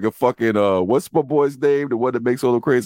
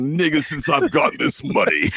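put-on English accent; 4 semitones higher than usual. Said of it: "English accent".

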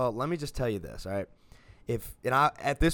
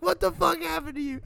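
high, laughing shout of disbelief; 4 dB louder than usual. Retold or imitated. Retelling laughing at drunk friend Alex, high laughing yell. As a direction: crack up while yelling at a fallen friend.

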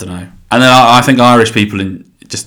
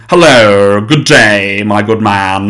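louder, plummy mock English accent; exaggerated English accent; 5 dB louder, much slower than usual. Mocking how films portray Englishmen, posh English accent. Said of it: "Like, very".

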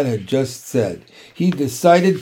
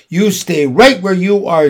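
loud, higher motherly command; firm order; 4 semitones higher, 7 dB louder than usual. A narrator's reading. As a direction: put your foot down, loudly. Mother forbids Fern to go.

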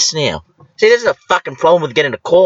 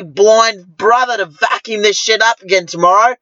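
usual speaking voice

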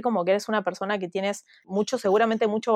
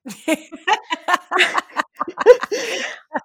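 high bright burst of laughter; 9 semitones higher than usual. Real, not acted. Woman laughing about her outburst, real laughter. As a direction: laugh at the memory.

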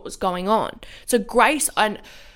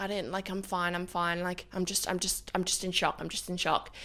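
much softer, murmured to herself; quiet self-reassurance; 9 dB quieter than usual. Retold or imitated. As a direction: talk yourself down quietly. Retold inner reassurance in shock.

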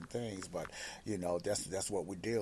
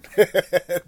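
a high, loud burst of laughter; 10 semitones higher, 18 dB louder than usual. Real, not acted. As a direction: crack up at your own joke. Laughs at his own joke.

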